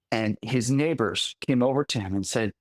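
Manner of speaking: ordinary delivery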